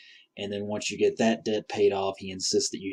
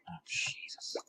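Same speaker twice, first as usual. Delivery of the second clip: real whispered exclamation under the breath; hushed, voiceless gasp of dismay; muttered dismay; 13 dB quieter, slower than usual. Real, not acted. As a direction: whisper it to yourself, caught off guard. Startled by monsters mid-game.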